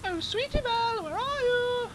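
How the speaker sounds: very high, loud put-on falsetto; Rarity. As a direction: attempt a squeaky-high character call. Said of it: "your turn to try and top my Rarity voice".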